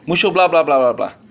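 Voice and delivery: quick blah-blah tumbling downward; coworkers' mock chatter. Preacher repeating coworkers' tease about talking too much, mock blabbing. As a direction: rattle off the teasing blahs. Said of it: "You talk too much".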